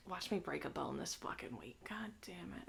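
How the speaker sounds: hushed whispered aside, half to himself. Real, not acted. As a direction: drop to a whisper as if afraid of jinxing it.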